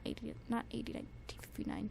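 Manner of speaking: fast, unvoiced mutter fixing the title; whispered self-correction. Real, not acted. Correcting her own slip in a fast whisper. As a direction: whisper the correction under your breath, quickly. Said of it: "darn it".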